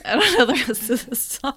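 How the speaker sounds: laughing. Real, not acted. Laughing after the song.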